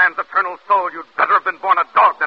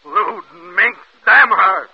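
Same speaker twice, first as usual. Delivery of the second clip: louder, biting curses; husband cursing his wife in fury; furious curse; 2 semitones higher, 6 dB louder, much slower than usual. Acted. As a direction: condemn her in rage.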